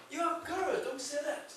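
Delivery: mild anger. Acted. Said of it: "I said angrily".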